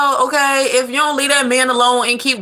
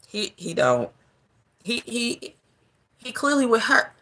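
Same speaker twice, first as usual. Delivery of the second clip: muttered reply; 8 dB quieter than usual. Acted. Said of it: "everybody just be like".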